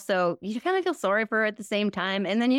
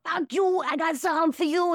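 high, screamy Bronx rant; Bronx Bober; 10 semitones higher than usual. Acted. Parodying a woman screaming in her car, high Bronx yell. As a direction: yell it like an angry Bronx driver. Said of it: "she screams in a car".

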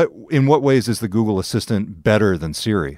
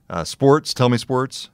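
ordinary delivery

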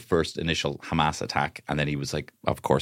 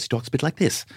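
higher-pitched impression of an actor; Paul Mescal; 8 semitones higher than usual. Correcting the joke impression of Mescal, higher lightly imitated voice. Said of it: "he doesn't talk like that, of course".

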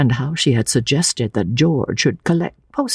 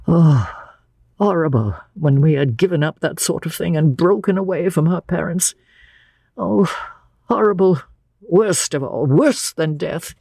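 horrified, pitched well above usual; higher-pitched shudder of revulsion; shuddering horror; 6 semitones higher than usual. A narrator's reading. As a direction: recoil from the memory, voice lifted. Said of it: "He shuddered".

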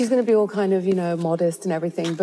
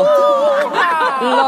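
laughing; 7 dB louder than usual. Real, not acted. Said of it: "laugh during mic test".

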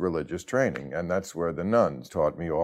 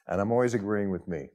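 ordinary talk

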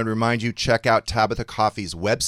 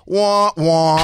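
mock sad trombone; 6 semitones higher, 11 dB louder than usual.

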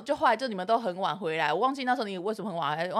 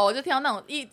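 usual speaking voice

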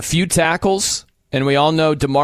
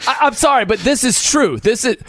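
ordinary delivery

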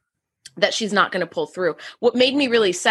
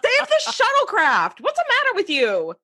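much higher, fed-up rant; mock exasperated scolding; 12 semitones higher than usual. Viewer scolding the commander to save the shuttlecraft, higher and exasperated. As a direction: scold her, pitched way up.

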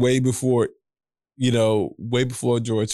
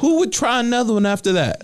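1 incredulous; 12 semitones higher, 4 dB louder than usual. Real, not acted. Incredulous rant continues.

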